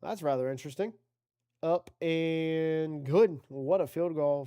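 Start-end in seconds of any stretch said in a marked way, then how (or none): 1.6-4.4 slow, loud, drawn-out field goal call; big drawn-out announcement; emphatic call. Real, not acted. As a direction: stretch it out like a broadcaster. Calls a made field goal.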